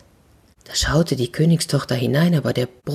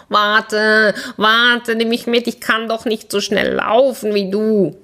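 higher, pleading frog calling after her; 6 semitones higher, slower than usual. Acted. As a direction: call after the running princess, begging. Frog calls after princess.

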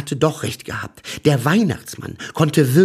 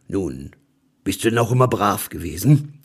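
deep Santa voice; 4 semitones lower than usual. A narrator's reading. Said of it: "mit tiefer Stimme".

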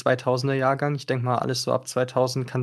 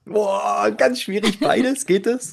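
drawn-out, much higher, torn between the two; mock agonizing; 7 semitones higher, slower than usual. Real, not acted. Guest torn between Android and iOS, high and drawn-out. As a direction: groan over an impossible choice, pitch way up and slow.

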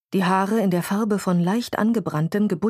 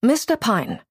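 higher, brisk call of his name; brisk address; 4 semitones higher than usual. A narrator's reading. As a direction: address him sharply, no greeting. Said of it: "sagte sie in versöhnlicherem Ton".

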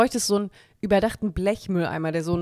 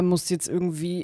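normal talking voice

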